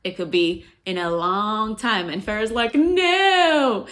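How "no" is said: high, loud cry of disbelief; dismayed no. Retold or imitated. Heroine realizing she was tricked, crying out high and loud. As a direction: let out a stricken high no. Heroine learns the captives stay.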